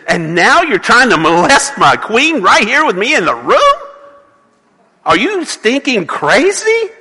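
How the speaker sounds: indignant royal outburst, climbing high; furious king. Acted. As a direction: bellow it like a betrayed king. Furious king catches Haman.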